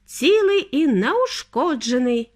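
high, drawn-out delight; elated, slow exclamation; overjoyed. A narrator's reading. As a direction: announce it joyfully, stretching the words. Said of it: "зрадів містер Вонка".

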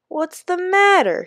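high, slow Jamaican guard; Jamaican accent. Acted. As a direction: kindly ask in a Jamaican lilt. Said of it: "spoke with an accent that Danny really liked".

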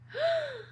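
high, quick gasp; storybook gasp; shocked gasp. A narrator's reading. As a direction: suck in air in sudden realization. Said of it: "Dakota gasped".

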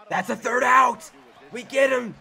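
high, loud victorious call; real relieved celebration; triumphant cheer. Real, not acted. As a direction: shout it as the inning is won. Cheering end of the inning.

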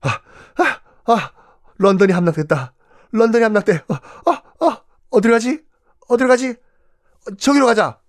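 high, whimpering panic; panicked king. Acted. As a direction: flee in a frantic, shaky rush. 도망치는 왕이 허둥댐. (겁에 질린 허둥지둥 목소리)

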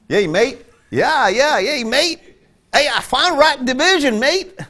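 a cheerful mock Australian "mate"; Australian accent. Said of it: "that nice accent they have".

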